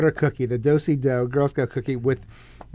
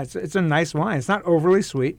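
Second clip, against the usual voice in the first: normal talking voice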